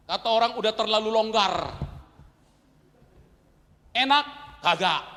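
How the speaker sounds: high, loud sermon delivery; full-force preaching. Acted. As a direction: preach it out to the whole hall.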